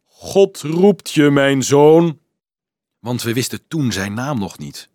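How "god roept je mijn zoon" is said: slow, loud, hollow voice of God. A narrator's reading. Anselmus fooling the shepherd boy, slow hollow divine call. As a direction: boom it out like a voice from heaven. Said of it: "riep met holle stem".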